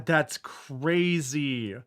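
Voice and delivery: ordinary delivery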